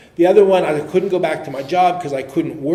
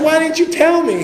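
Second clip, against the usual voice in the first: mock indignation; 13 semitones higher, 8 dB louder than usual. Mock indignant question to inmate.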